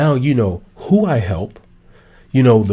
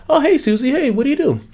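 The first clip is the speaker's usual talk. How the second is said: friendly asker; 13 semitones higher, faster than usual. Acted. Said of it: "here's a better way".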